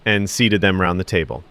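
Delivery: usual speaking voice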